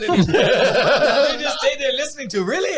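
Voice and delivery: laughing high and loud. Real, not acted. Storyteller cracking up telling friends about the fight, laughing. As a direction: laugh through the words.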